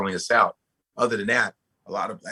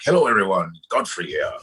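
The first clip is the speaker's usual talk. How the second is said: character voice. Retold or imitated. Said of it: "the different characters I do".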